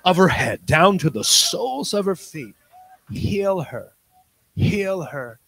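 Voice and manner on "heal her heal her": Oral Roberts healer. Retold or imitated. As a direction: call out the healing like a tent revivalist. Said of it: "I just went Oral Roberts on her".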